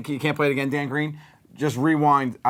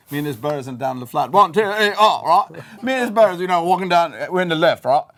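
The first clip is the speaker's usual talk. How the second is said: loud, hammed-up British accent; bad mock-British geezer, pitched up; 5 semitones higher, 6 dB louder than usual. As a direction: put on a rowdy British accent. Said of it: "Can you do a British accent?".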